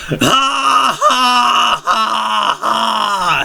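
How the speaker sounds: high, slow mocking villain laugh; staged villain laughter, held long; villain's laugh. A narrator's reading. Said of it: "laughing as he came".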